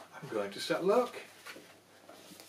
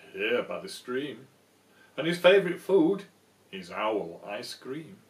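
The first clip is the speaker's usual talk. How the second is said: sly mouse; 3 semitones lower, 10 dB louder than usual. Acted. Mouse springs the trap.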